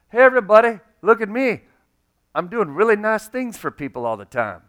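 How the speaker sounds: loud, high, boastful show-off call. Pretend. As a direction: announce your good deeds to the whole room. Mocking a showy giver.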